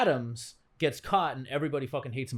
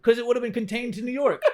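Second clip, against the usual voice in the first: raised-pitch, hammed-up New York accent; put-on New York accent; 6 semitones higher, 3 dB louder than usual. Comic riffing about a New York mayor, put-on New York accent. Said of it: "you bring out the accent".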